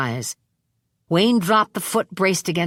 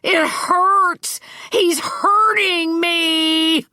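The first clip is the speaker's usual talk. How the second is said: much higher, louder, a crying shriek of pain; 12 semitones higher, 4 dB louder, much slower than usual. Acted. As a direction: shriek it like a bitten bully. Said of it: "The gas mask man shrieked".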